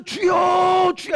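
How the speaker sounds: high, loud, drawn-out call; crying out to God. Shown as a demonstration. Preacher showing how believers call on the Lord, crying out. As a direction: cry out "Lord" with a long, held vowel. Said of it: "부르짖는 겁니다".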